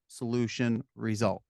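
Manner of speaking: ordinary delivery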